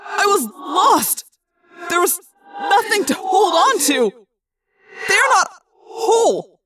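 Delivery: high, loud, slow terrified voice; phobic panic. Acted. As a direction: speak in shuddering horror. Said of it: "He sounded terrified".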